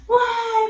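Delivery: loud, high crying wail; mock wail. Susana crying over the lost cake, high loud mock wail. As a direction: bawl like a little kid. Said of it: "Empezó a llorar".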